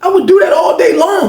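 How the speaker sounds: high emphatic yell; emphatic shout. Real, not acted. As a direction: belt it out to make the point. Emphatic outburst to audience.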